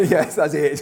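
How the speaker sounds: loud laughing yes. Real, not acted. Laughing reply to congregation.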